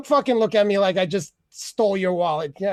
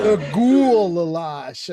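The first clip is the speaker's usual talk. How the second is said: drawn-out relish; 4 semitones lower, much slower than usual. Acted. Repeats the goulash bit.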